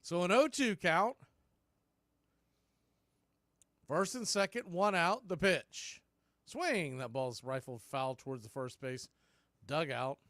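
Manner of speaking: steady radio call, pitch by pitch; live play-by-play. Real, not acted. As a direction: call each pitch to the listeners.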